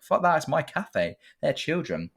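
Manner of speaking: mock-outraged café owner snapping back; indignant cafe owner.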